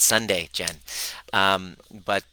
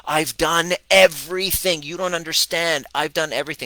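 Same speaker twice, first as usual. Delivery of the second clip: much higher and louder, exasperated insistence; mock client pleading with the coach, higher and louder; frustrated client; 7 semitones higher, 6 dB louder than usual. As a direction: protest like a client at her wits' end. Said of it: "and she goes".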